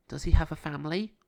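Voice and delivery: lightly voiced young girl; Nina's voice. A narrator's reading. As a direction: lift the voice for young Nina. Nina voiced higher in story.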